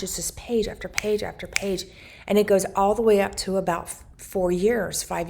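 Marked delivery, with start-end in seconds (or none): 0.0-1.8 hushed, breathless whisper of wonder; awed whisper. Retold or imitated. Flipping through the baby book, a hushed awed whisper. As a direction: whisper it like you can't believe your eyes.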